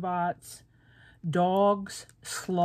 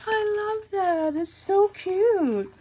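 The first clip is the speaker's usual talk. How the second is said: much higher, slow and louder; gushing squeal; 12 semitones higher, 5 dB louder, much slower than usual. Real, not acted. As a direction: drag it out with delight. Gushing over princess baggie.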